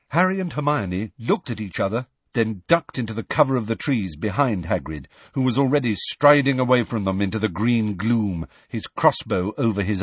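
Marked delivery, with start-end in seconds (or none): none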